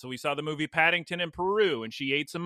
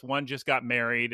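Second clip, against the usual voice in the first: ordinary delivery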